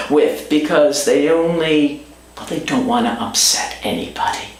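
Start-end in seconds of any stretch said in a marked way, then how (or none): 2.3-4.5 softer, fast, half-whispered dig; sarcastic aside. Real, not acted. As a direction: mutter it like a sideswipe at officials. Sarcastic aside about authorities.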